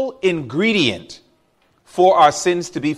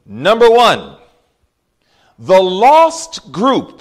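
very slow, louder; slow emphatic point; 6 dB louder, much slower than usual. Real, not acted. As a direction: stress each word of the lost group. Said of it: "please notice this".

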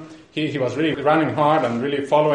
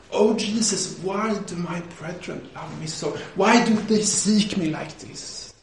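slow, softly acted tearful plea; weeping prayer; 2 semitones higher, 4 dB quieter, slower than usual. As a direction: imitate a man praying through tears. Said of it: "he's weeping, and he says, he prays".

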